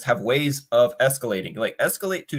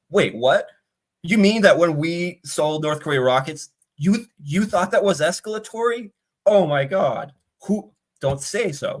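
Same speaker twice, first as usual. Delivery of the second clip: higher, hammed-up shocked voice, slowing at the end; mock innocent surprise; 5 semitones higher than usual. Russians feigning shock at the Americans' escalation claims, mock innocent surprise. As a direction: play the Russians gasping with fake astonishment.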